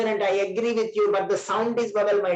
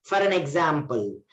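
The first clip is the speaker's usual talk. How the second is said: ordinary delivery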